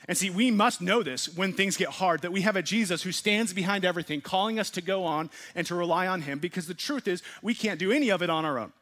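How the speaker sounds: urgent pulpit fire; fervent preaching. Acted. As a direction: build it up like a rousing call. Preaching at full force.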